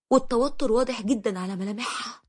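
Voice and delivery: ordinary delivery, nothing marked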